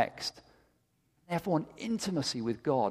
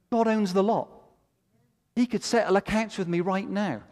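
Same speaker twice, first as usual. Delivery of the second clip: raised, ringing preacher's voice, building with conviction; fervent preaching; 7 semitones higher than usual. Acted. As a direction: preach it out with full fire. Preaching at full force.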